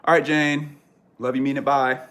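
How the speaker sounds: loud cheery sign-off; cheery goodbye. Real, not acted. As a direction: send the viewer off with a burst.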